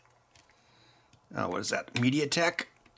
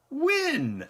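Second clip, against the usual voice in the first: excited. Real, not acted. Celebrating cheap parts haul.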